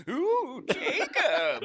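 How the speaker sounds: lightly voiced spooky old man ghost; a hint of a moaning ghost calling out.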